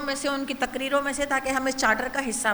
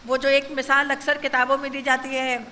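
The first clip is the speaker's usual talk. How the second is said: ordinary delivery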